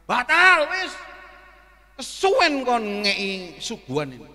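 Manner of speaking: bentakan tinggi dan keras pelanggan kesal; marah-marah. Retold or imitated. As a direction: tirukan pelanggan membatalkan pesanan dengan membentak. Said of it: "Pernah ada yang marah-marah?". (tiruan pelanggan marah-marah)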